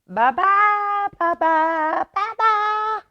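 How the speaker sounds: very high calling cub voice; high squeaky calling. Acted. Baby Bear calling goodbye to Aluki, very high squeak. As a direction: call it after her, tiny and sweet. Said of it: "high, squeaky voice calling to her".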